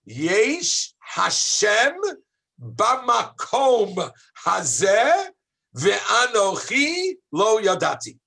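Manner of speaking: slow, emphatic reading of a verse; slow declamation. Shown as a demonstration. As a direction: draw the verse out slowly, word by word. Teacher slowly declaims the verse.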